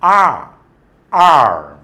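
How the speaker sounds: "आर्म" with a long open "aa", loud; long aa vowel. Shown as a demonstration. Teacher drilling vowel for class, loud "आर्म". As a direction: stretch the "आ" in "आर्म". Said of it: "यहाँ हो गया".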